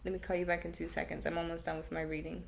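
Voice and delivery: quick, hushed brush-off; dodging a caller. Real, not acted. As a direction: hurry him off the phone. Hurries husband off the phone.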